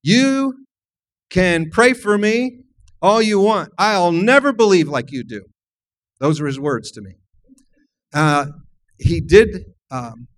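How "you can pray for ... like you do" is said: finger-wagging, louder defiant retort; a dying brother wagging his finger at the believer, defiant and loud. Retold or imitated. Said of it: "he shook his finger at me and said".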